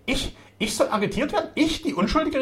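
very high, loud protest; indignant outcry. A narrator's reading. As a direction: cry out as the wronged innocent. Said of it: "rief Zaba".

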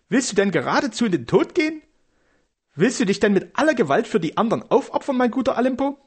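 high, agitated wifely voice; alarmed protest. A narrator's reading. Wife fearing her husband will be killed, alarmed. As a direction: plead in high-pitched, incredulous questions.

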